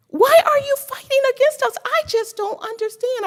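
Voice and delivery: pleading, voice high and loud with emotion; emotional plea. Real, not acted. Pleading with the mayor, voice rising in real distress. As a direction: ask why, close to tears. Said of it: "But I am just so emotional".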